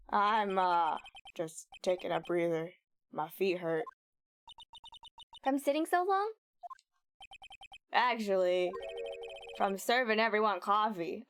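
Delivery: a hint of the gruff detective, New York tinge; mild New York cop accent for the detective; Gumshoe accent. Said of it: "my Gumshoe accent".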